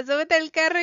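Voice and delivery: retold urgent shout; insistent yell. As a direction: bark it at her the second time. Guy repeats order after refusal.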